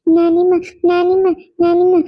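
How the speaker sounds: put-on lightly childlike repeated calling for granny; child calling. Little girl calling her grandmother, mild childlike call.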